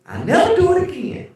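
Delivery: high, loud mock wail; mock pained cry. Kid crying out under the extension cord.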